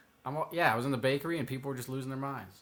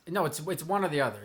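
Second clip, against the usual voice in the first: ordinary talk, nothing marked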